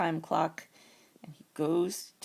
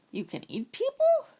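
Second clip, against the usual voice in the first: high, louder amazed squeak; shocked and delighted, pitched way up; amazed bear; 4 dB louder than usual. Acted. Young bear learns it can eat people, high amazement.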